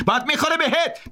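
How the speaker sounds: high yell of warning; shouted threat. A narrator's reading. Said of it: "ارنی داد زد".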